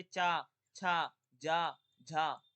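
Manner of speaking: चा, छा, जा, झा slow and loud; affricate demo. Shown as a demonstration. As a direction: model each syllable. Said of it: "स्पर्शी संघर्षी व्यंजन कौन कौन से हैं". (अक्षरों का धीमा ज़ोरदार उच्चारण)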